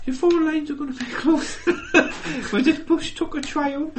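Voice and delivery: very high Geordie voice; high-pitched Geordie double act; Ant and Dec Geordie. Acted. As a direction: squeak it out in Geordie, laughing. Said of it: "you got your Ant and Dex".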